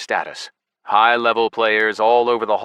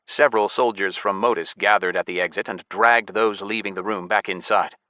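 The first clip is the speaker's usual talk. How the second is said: plain reading voice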